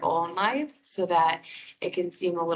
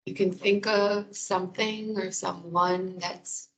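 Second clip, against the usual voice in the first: guided meditation. Real, not acted. Wellness coordinator guiding listeners through breathing and muscle release, guided meditation.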